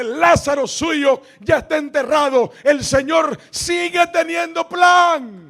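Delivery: full-throated, high, slow shout; booming pulpit cry; preaching shout. Real, not acted. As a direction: proclaim it with everything you have. Pastor's climax, preaching loudly.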